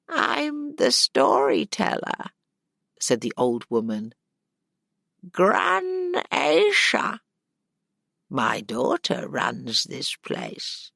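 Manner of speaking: high, slow crone voice; a cheerful stubborn old storyteller; old woman voice. Acted. Old woman introduces herself.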